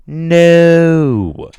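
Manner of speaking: high, loud flat refusal; refusing to trade limbs, loud; shouted no. Pretend.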